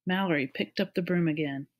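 ordinary delivery, nothing marked

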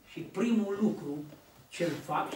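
usual speaking voice

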